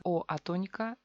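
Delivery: ordinary talk, nothing marked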